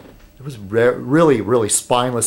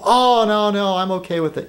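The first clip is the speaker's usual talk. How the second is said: higher, gushing shop owner voice; camp shop owner; 9 semitones higher than usual. Retold or imitated. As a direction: play the delighted campy dealer. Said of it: "pretty, like, camp".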